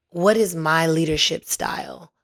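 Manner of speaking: usual speaking voice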